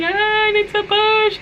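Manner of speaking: high, strained cry of urgency; desperate plea to push. Retold or imitated. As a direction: beg like the baby is coming now. Urgent retold plea in labour.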